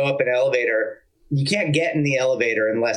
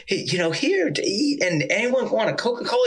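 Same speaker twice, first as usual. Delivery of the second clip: Ornette's hospitable voice; 8 semitones higher than usual. Retold or imitated. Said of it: "Southern hospitality".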